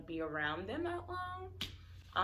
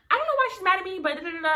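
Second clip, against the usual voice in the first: high, loud, fast imitation of a yelling friend; mock screaming; 11 semitones higher, 10 dB louder, much faster than usual. Mocking the friend who screamed at her on the phone, a loud fast high mock scream. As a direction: screech it back the way she yelled, mocking. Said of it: "she's, like, screaming".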